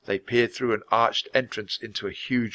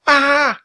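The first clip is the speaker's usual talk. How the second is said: very high, loud shriek; frightened scream; 9 dB louder than usual. A narrator's reading. Annie seeing the floating bone, high frightened scream. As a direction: scream at the top of the voice. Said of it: "yelled Annie".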